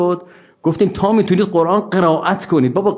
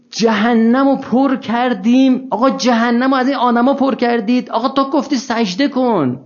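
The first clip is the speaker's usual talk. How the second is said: mock protest to God; 7 semitones higher, slower than usual. Man voiced protesting to God.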